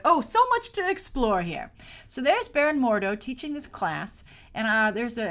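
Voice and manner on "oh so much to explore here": eager wonder. Retold or imitated. Own excited reaction quoted.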